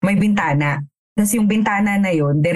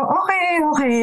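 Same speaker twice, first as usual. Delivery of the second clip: mock polite dismissal; 9 semitones higher than usual.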